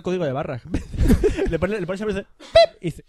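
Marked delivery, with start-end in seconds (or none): 2.4-2.8 barcode beep. Retold or imitated. Imitating a barcode scanner beep.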